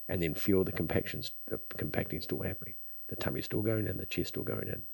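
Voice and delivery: soft, calm breathing instruction; guided breathing. Acted. Guides breathing exercise, soft and slow.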